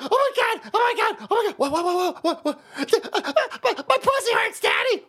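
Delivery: high, stuttering hysterical whine; mock crybaby. Host mocking weak people crying about everything, wailing whine. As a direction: blubber like a spoiled child, hammed-up. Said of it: "crying about fucking everything".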